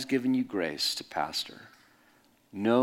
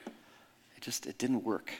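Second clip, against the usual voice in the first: disappointed muttering to self; 8 dB quieter, slower than usual. Retold or imitated. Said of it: "I was really disappointed".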